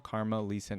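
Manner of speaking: ordinary talk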